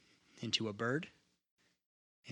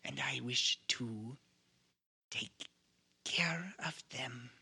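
hushed, slow whisper; slower than usual. Acted. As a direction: breathe the words slowly. NPC speaking in character.

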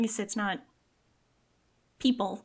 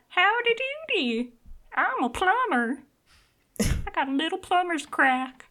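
high hillbilly plumber voice; squeaky folksy rat character; cartoon rat voice; 10 semitones higher than usual. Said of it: "It was like".

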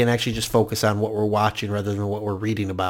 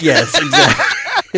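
laughing; 7 semitones higher, 6 dB louder, slower than usual. Real, not acted. Laughing agreement at joke.

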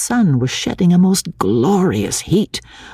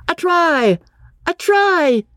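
high excited yell of A try; cheering a try at play; slower than usual. A narrator's reading. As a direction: shout it across the field. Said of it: "yelled Freddy".

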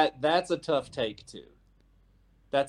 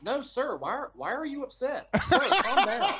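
mimicking the co-host playing the victim; mock innocent; 6 semitones higher than usual. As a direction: act wounded and polite, hammed-up. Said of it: "you're like".

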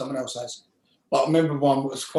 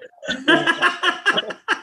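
big high laugh. Real, not acted. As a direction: burst out laughing. Laughing at the memory.

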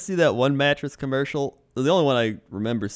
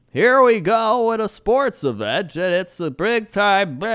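louder, higher, slow announcer monotone; Joe Buck impression; 8 semitones higher, 5 dB louder, slower than usual. Mocking the play-by-play man's unchanging tone, slow announcer voice. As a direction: hype a sports event in one fixed tone. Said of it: "he's just like".

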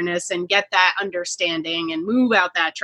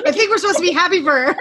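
laughing; 10 semitones higher, faster than usual. Real, not acted. Laughing joke about being happy.

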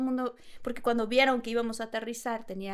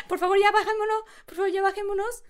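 scared pleading; 8 semitones higher, 5 dB louder than usual. Retold or imitated. Begging to land, scared.

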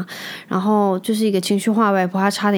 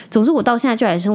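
ordinary talk, nothing marked

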